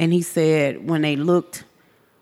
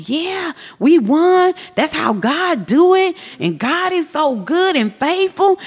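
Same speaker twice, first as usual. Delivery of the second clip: sing-song mock rejoicing, pitched way up; mock cheering; 11 semitones higher than usual. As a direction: imitate a jubilant crowd in a high voice. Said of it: "They were cheering".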